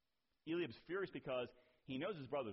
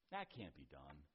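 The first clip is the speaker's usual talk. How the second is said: low, soft naysayer voice; discouraging mutter; 11 semitones lower, 7 dB quieter than usual. Retold or imitated. Voice of discouragement to someone speaking up, low and dismissive. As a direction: quietly shut the idea down.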